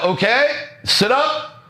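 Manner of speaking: slow, high, rising instruction; imitated slow class prompt; class instructor. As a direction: gently lift each phrase like an instructor. Acted instructor addressing the class.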